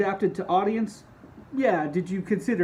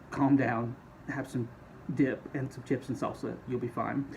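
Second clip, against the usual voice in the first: soothing; 4 semitones lower, 7 dB quieter than usual. Acted.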